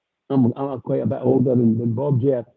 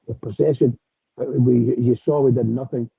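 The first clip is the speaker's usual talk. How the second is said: ordinary talk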